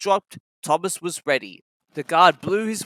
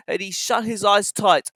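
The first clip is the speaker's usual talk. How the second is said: plain reading voice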